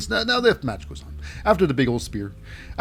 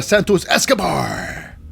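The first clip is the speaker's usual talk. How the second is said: loud, drawn-out ring introduction; hyped ring-announcer call; announcer name reveal; 3 semitones higher, 5 dB louder, slower than usual. Acted. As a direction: build up and stretch the last name.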